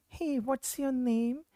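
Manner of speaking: weak husky patient voice, drawn out. Shown as a demonstration. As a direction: show a voice that can't tighten its cords, slowly. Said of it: "The person talks in a very low-pitched voice".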